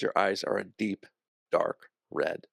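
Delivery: usual speaking voice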